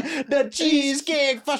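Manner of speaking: high mock Jamaican beer can and Cheesecake Factory; imitating a Jamaican accent, up high. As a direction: belt it out in a hammed-up Jamaican accent. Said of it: "replaying the Jamaican accent".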